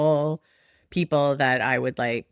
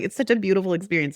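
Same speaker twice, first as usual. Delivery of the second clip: higher, rushed gushing platitude; mock gushing; 5 semitones higher, 3 dB louder, much faster than usual. Mother mimicking what everyone says about birth, mock gushing. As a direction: rattle it off as everyone's cliché. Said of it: "everyone's like".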